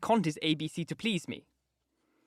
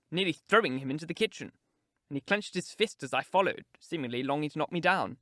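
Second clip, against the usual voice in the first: usual speaking voice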